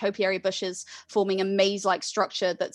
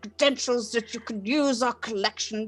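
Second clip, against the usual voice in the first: put-on louder elderly librarian voice; older male librarian; 4 semitones higher, 4 dB louder than usual. Librarian asks student for credentials, elderly voice. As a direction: question a visitor like an old librarian. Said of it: "sort of an older man".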